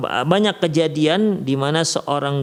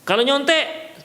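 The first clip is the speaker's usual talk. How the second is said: much higher, stern teacher voice; firm exam warning; 10 semitones higher than usual. Shown as a demonstration. Teacher warning the class before an exam, stern higher voice. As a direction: lay down the rule with force. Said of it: "si guru menegaskan".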